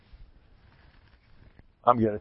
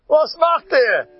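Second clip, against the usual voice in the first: loud, much higher shouting summons; shouted call in Yiddish; 14 semitones higher, 6 dB louder, slower than usual. Acted. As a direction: yell to call the crowd inside. Voicing someone calling people in.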